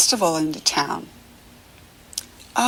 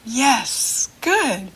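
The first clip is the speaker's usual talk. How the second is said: ordinary talk, nothing marked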